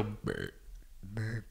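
low rumbling burp noise; burp sound. Shown as a demonstration. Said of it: "No, do this".

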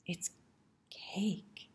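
ordinary delivery, nothing marked

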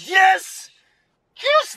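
high, cheering yes; real joyful cheers; triumphant yes. Real, not acted. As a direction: celebrate the catch. Catch succeeds.

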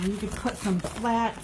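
usual speaking voice

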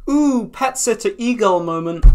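high, loud mock gush; mock excited squeal. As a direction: squeal it like a fan. Mocking excited aside.

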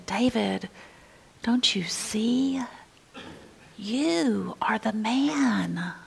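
breaking the news gently through tears; trembling whisper. Acted. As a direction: Nathan whispering a painful truth to David. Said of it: "with a trembling voice".

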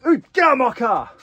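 sharp high yelp, loud and sudden; startled shout. Real, not acted. Vlogger shouting at something in his car, startled yell. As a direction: shoo it out of the car with a jolt.

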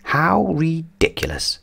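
slow, quiet, high-pitched grumble; grumbling. A narrator's reading. Mister Grumble at the huge tent, grumbling. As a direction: grumble it with drawn-out disapproval. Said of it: "grumbled Mister Grumble".

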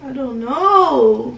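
loud, drawn-out exasperation; getting mad. Pretend. Girlfriend pressed on what happened, getting mad. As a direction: push back loudly, stretching the words. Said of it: "Why are you getting mad at me?".

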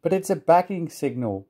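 storyteller's indignant little engine; protesting. A narrator's reading. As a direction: object as if surely in the right. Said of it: "Percy protested".

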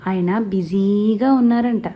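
slow, teasing drawl; drawn out. Acted. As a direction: stretch every word out, slowly. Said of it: "ఫోన్లో దీర్ఘం తీసింది".

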